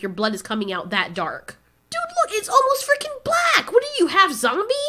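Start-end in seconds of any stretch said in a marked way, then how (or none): 1.9-4.7 high-pitched, incredulous exclamation; incredulous outburst. Real, not acted. Reviewer reacting to dark movie blood, incredulous. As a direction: gawk at it, voice jumping way up.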